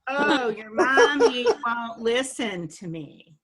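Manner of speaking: loud, slow mocking jab at mommy, with a laugh; half-laughing loud dinner-table taunt; mocking dad. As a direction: ham up a sneering dad needling mom through the kid. Dad mocking mom to kids.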